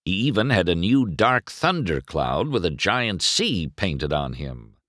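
plain reading voice

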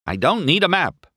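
high-pitched, insistent refusal; stubborn. A narrator's reading. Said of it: "said stubbornly".

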